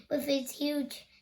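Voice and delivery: plain reading voice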